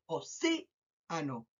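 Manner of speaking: océano slowly split, long océ, pause, soft ano; stress on CE. Shown as a demonstration. Teacher breaking down the word for pupils, CE stressed in océano. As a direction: hold CE, then drop away on ano. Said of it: "marco más la palabra en C".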